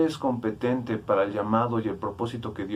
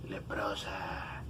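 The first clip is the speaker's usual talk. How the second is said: slow, hushed whisper; breathy stage whisper; 3 semitones lower, 13 dB quieter, much slower than usual. Pretend. Preacher playing with a word as a band name, slow whisper.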